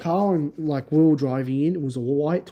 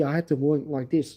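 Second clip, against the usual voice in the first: usual speaking voice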